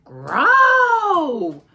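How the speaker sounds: loud hammed-up growl; bear growl. Bear growling at the granny, loud and playful. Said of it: "Old Bear, growl".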